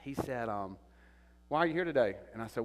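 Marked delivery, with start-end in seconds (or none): 1.5-2.2 sharp official question. Retold or imitated. Official confronts him.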